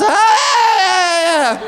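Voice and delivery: long, high, loud scream; hammed-up scream ringing off walls; mock echoing scream. Catcaller biking away, long mock scream. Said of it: "It reverberated off the walls".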